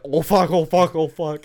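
loud, high panicked cursing; frantic, caught out; panicked guy. Retold or imitated. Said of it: "enough time for him to be like".

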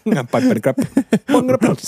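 laughing mock Dutch jokes; giggly high laughing. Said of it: "should have read it in a Dutch accent".

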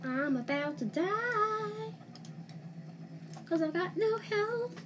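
high, anxious alarmed voice; panicking gamer. Real, not acted. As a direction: sound worried you are about to lose. Low health, near death in game.

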